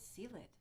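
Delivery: Seelid said as spelled, with an S sound at the start; naive mispronunciation. Retold or imitated. Husband puzzling over a coworker's baby name, Seelid mispronounced with an S. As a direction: say Seelid with "see" first, not "kay". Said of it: "we couldn't get it".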